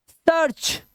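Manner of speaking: "सर्च" high with an open uh before r; uh sound. Shown as a demonstration. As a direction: stretch "सर्च" as surch. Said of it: "आ की आवाज".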